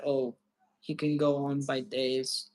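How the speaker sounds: ordinary talk, nothing marked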